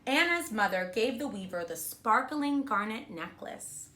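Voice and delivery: ordinary delivery, nothing marked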